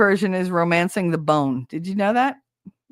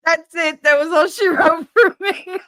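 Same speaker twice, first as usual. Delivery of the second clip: normal talking voice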